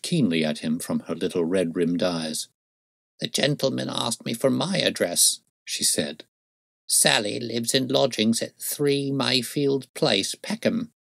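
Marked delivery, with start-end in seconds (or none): 3.2-5.4 put-on higher old-woman voice; high-voiced crone correcting a questioner; defensive old crone. A narrator's reading. Old crone answers Holmes. 6.9-10.9 put-on slow, higher old-woman voice; high-voiced crone, drawn out; deliberate old crone. A narrator's reading. Old crone gives address.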